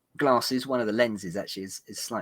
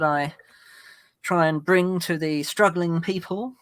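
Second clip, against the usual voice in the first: thunderous wrathful prophet; 5 semitones higher, 5 dB louder than usual. Acted. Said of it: "Sound like a prophet".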